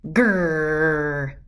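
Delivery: low rolled growl; dog growl. A narrator's reading. Story reader imitates Mister Barker's growl.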